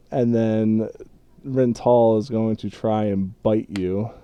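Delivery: ordinary delivery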